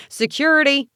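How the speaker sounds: calling out. A narrator's reading. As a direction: summon help across a room. Calls guards to remove intruder.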